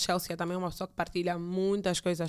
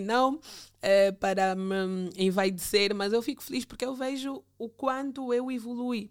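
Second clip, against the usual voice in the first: ordinary delivery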